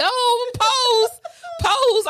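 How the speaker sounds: high, cooing "pose, pose"; proud mama voice. Retold or imitated. Posing her date for a photo, a high mock-proud mama coo. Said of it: "I'm sitting here like his mama".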